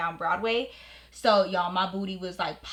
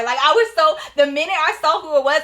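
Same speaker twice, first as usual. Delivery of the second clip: normal talking voice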